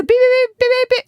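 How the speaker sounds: very high rapid-fire censor beeps; imitating Morse-code bleeps. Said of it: "am I listening to Morse code?".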